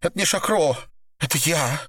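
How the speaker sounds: high, small voice after a sniffle; tearful confession. A narrator's reading. Woman confesses to captor, tearful high voice. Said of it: "всхлипнула".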